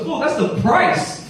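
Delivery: high, drawn-out scolding; exasperated wife. Retold or imitated. Wife correcting her clueless husband, acted exasperation. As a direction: spell out his blunder in high exasperation.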